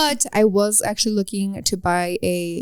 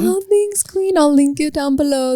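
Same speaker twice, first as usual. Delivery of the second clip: squeaky high thanks; high-pitched; 6 semitones higher, 3 dB louder than usual. Real, not acted. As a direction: pitch way up playfully.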